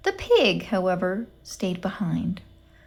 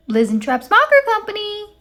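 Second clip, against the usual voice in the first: much higher, sugary phone greeting; annoyingly sweet voice; 13 semitones higher, 8 dB louder than usual. A narrator's reading. Gladys answering the company phone, annoyingly sweet. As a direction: sing it out sickly sweet, like a too-cheerful receptionist. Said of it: "said an annoyingly sweet voice".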